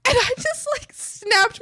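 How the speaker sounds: high burst of laughter on the words; laughing. Real, not acted. Recalling the friend's Doritos mistake, laughing out loud. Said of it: "laughs heard".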